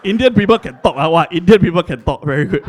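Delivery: loud patronising praise in another voice; mock condescending. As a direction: impersonate a smug friend. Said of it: "my Chinese friends".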